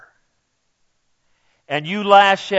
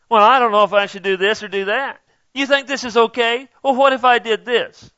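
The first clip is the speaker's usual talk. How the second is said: higher, faster mock of a dithering questioner; 6 semitones higher than usual. Preacher mocking people asking obvious questions, raised pitch. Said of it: "these people coming around".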